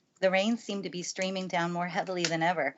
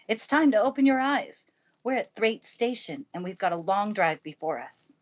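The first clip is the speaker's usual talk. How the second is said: brisk housekeeper voice, higher and louder at the start; Mrs. Medlock, louder; 4 semitones higher, 5 dB louder than usual. Acted. Housekeeper urging the girl off the train, brisk and louder. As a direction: rouse the girl firmly, then settle.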